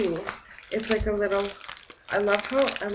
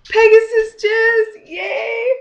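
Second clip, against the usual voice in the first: much higher, slow excited squeal; squealing delight; 14 semitones higher, 10 dB louder, much slower than usual. Real, not acted. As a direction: squeal the name in joy. Thrilled at getting a gift.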